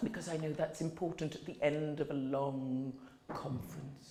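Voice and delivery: quiet, grounded conference tone; serious good tone. Shown as a demonstration. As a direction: settle into a calm, serious talk voice. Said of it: "I can become serious and talk with a good tone".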